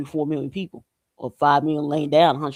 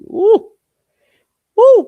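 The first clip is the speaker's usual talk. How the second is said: two loud, high hoots; high whoops; 13 dB louder than usual. Real, not acted. Vlogger reacting to the cold game, high whoops. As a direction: whoop it out high, then once more.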